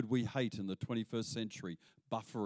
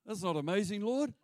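higher, rising objection to the Lord; indignant protest; 7 semitones higher than usual. Retold or imitated. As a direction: push back at God in disbelief. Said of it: "That's what Habakkuk says".

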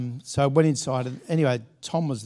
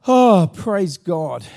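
praise; 4 semitones higher, 12 dB louder, much slower than usual. Real, not acted. Preacher's joyful exclamation.

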